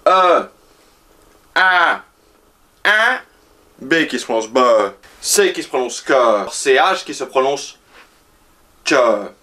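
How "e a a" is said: each letter said clearly, a pause between; Vietnamese letter sounds. Shown as a demonstration. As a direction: spell out the letters slowly, like a teacher. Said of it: "Alors déjà pour commencer".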